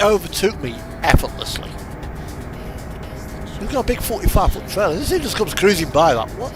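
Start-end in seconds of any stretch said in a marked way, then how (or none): none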